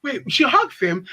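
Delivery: usual speaking voice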